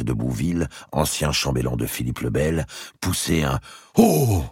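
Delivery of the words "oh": a high, loud scandalized gasp; shocked oh. A narrator's reading. Old Bouville scandalized by the lie. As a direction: gasp in outrage. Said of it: "scandalisé".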